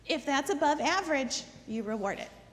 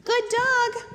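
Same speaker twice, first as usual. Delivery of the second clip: excited praise; 7 semitones higher, 4 dB louder than usual. Shown as a demonstration. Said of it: "praise after the sit".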